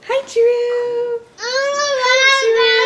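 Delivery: high, loud squealed hi; excited squeal. Real, not acted. Excited greeting to baby.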